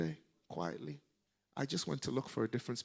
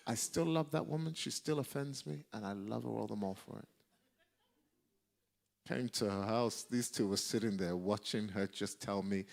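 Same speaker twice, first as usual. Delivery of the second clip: ordinary delivery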